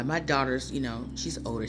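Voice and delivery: normal talking voice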